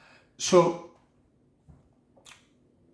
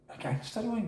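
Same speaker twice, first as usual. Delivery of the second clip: much softer, higher questioning echo; puzzled echo; 7 semitones higher, 12 dB quieter than usual. Retold or imitated. New prisoner to officer about the wing, quietly puzzled. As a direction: repeat it back quietly, unsure what it means. Newcomer echoes it, taken aback.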